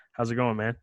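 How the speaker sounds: ordinary delivery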